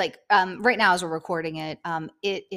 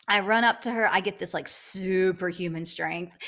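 ordinary delivery

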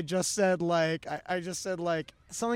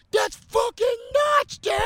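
loud, high mock yell of an amazed fan; imitating a shouting stoned fan; 5 dB louder than usual. Fan shouting at a comedian in a crowd, high mock yell. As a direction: belt it out like a stunned raver.

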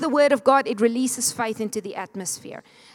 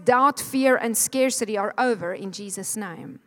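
normal talking voice

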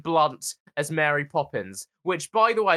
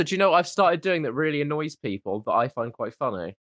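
ordinary delivery

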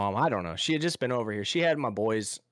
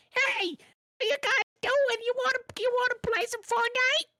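put-on squeaky kid voice. A kid on the game chat asking to play, squeaky high voice. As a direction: mimic an eager little kid on the headset, high. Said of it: "I got this squeaker".

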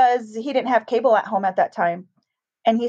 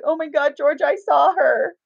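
put-on reliving the shock of seeing the ghost girl; frightened exclamation; 4 semitones higher than usual. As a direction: let it burst out half crying, half shouting. Retold realizing she saw ghost too.

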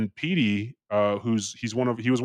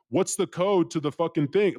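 higher-pitched retold cop demand; mild mock yell; imitated yelling; 7 semitones higher than usual. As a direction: mimic an insistent cop, voice raised in pitch. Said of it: "she's yelling at me for the code".

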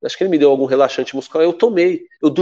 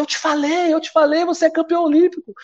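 a mild retold victory yell, much higher; joyful shout; 13 semitones higher than usual. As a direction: cry it out to your teammate in triumph. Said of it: "eu gritava".

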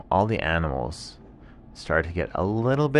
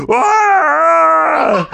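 high, loud laughing yelp; tiger's startled shout; 14 dB louder than usual. Acted. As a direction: shriek in mock fright. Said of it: "the tiger shouted so".

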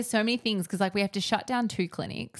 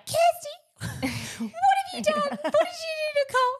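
very high, slow, laughing mock yell of a blaming dad; mock angry dad; 4 dB louder, slower than usual. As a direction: ham up dad blaming the kids through laughter. Dad blaming kids, mocked.

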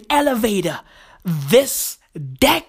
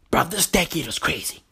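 ordinary talk, nothing marked

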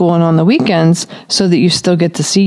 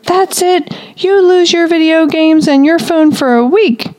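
high, sharp scolding punishment; angry parent; 10 semitones higher than usual. Acted. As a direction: lay down the punishment like a furious parent. Parent punishing child in anger.

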